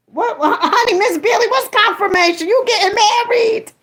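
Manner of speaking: high, excited squeal at a friend; excited teasing. Real, not acted. Teasing friend Miss Billy about marrying, high and excited. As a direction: gush at her in delight.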